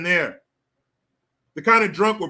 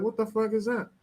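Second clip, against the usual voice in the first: retold astonishment; 3 semitones higher, 6 dB quieter than usual. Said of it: "I was like".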